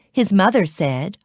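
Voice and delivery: usual speaking voice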